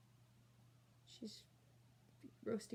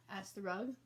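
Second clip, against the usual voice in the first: usual speaking voice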